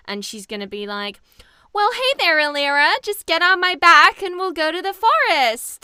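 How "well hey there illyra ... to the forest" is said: big, high, loud cartoon sidekick voice; Cree Summer. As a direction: belt it out as an over-eager animated butterfly. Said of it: "like, Cree Summer".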